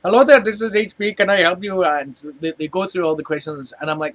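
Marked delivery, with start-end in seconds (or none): 0.0-1.8 put-on higher, louder Indian-accented phone greeting; Indian call-centre accent. Call-centre agent greets a customer calling HP, Indian accent. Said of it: "I tried calling up H P".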